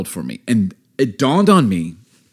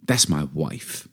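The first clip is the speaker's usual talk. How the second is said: low, quiet warning with long pauses; deliberate, hushed fatherly warning; stern father; 4 semitones lower, 5 dB quieter, slower than usual. Retold or imitated. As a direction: lower and soften into a menacing dad. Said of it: "He goes, "Son".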